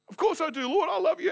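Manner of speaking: high, laughing and overeager; eager Peter. Acted. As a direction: gush it out high with a laugh, as Peter desperate to please. Peter answers Jesus, acted dialogue.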